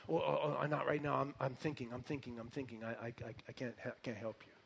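preoccupied god, quiet and low; Baal. Acted. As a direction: mumble distractedly, fading away softly. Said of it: "it's like Baal's going".

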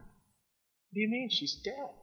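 high, slow disbelieving question; father stunned at Jesus' words; stunned disbelief. Acted. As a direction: ask it as if the ground fell away.

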